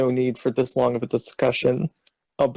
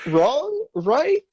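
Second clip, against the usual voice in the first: each word pitched far higher, questioning; awkward rising question; 10 semitones higher, slower than usual. Shown as a demonstration. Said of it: "it demands to end sentences awkwardly".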